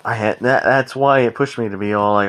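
ordinary delivery

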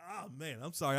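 ordinary delivery

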